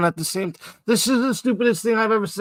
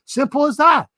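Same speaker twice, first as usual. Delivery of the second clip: usual speaking voice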